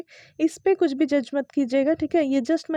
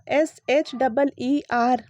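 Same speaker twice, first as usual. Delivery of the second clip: spelling out; 4 dB louder, much slower than usual. Shown as a demonstration. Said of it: "ये जो spelling है".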